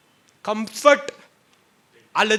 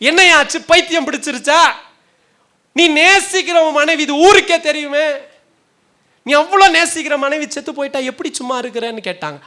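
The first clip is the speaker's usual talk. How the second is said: high, loud acted outcry of questioning; incredulous crowd; 7 semitones higher, 9 dB louder than usual. Townspeople question a prophet whose wife has died, loud and incredulous. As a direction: act out townspeople loudly challenging a man.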